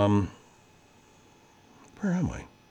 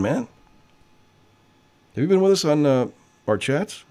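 normal talking voice

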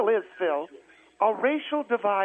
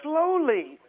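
higher, stretched-out demand; slowly; 5 semitones higher than usual. Shown as a demonstration. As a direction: draw the word out to demonstrate. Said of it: "I said say it slowly".

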